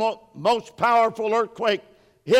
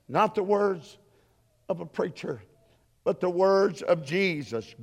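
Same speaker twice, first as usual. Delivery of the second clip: ordinary talk, nothing marked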